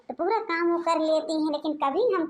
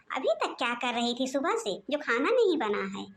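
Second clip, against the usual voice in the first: ordinary talk, nothing marked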